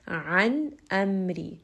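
An amri very slow, ain and hamza clearly apart; distinct throat letters. Shown as a demonstration. Teacher contrasting two throat letters, slow recitation. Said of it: "from the middle of the throat and a is from the lower throat".

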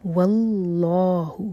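voiced, very slow tajweed recitation; slow Quran recitation. Shown as a demonstration. Teacher recites the verse to show the rules, slowly. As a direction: draw each syllable out carefully. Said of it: "لام جلالہ کو heavy پڑھیں گے".

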